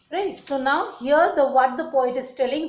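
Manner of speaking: usual speaking voice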